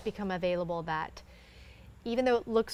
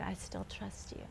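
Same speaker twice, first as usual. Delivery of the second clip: hushed prayer; 13 dB quieter than usual. Retold or imitated. Child's prayer retold.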